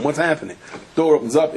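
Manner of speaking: ordinary talk, nothing marked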